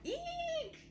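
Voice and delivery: very high comic frightened eek; lion's squeak. Acted. Lion terrified of the mouse, very high comic squeak.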